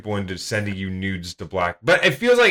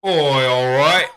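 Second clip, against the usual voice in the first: drawn-out, loud Aussie reply; Australian accent; 5 dB louder, slower than usual. Acted. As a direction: ham up a slow Australian "all right". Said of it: "Oh, wait, we're Australian".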